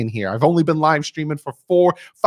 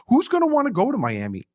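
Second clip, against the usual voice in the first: mocking doubters; 10 semitones higher than usual. Repeats the doubters' line.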